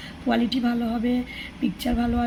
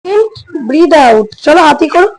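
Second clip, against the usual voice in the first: yoga instruction; 3 semitones higher, 14 dB louder, much slower than usual. Shown as a demonstration. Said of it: "আমি আশিকে yoga করাতে থাকি".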